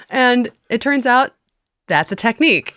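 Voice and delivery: normal talking voice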